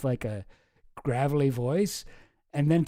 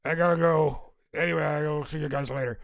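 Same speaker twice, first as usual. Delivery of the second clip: put-on a touch of drunk slur; lightly slurred farewell. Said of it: "And he's like".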